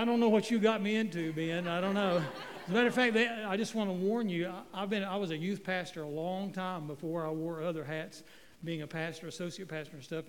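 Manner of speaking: ordinary talk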